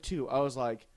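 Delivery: usual speaking voice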